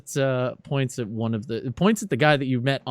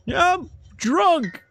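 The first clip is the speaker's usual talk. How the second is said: put-on much higher, slow drunken yell; drunk heckler; 4 dB louder, slower than usual. Drunk rally-goer yells from the back, high. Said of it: "somebody in the back screams".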